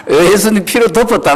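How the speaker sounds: high, bold claim of the blood; triumphant declaration. Shown as a demonstration. Preacher modelling the answer to the devil, triumphant. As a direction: declare it with victory. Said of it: "그러세요".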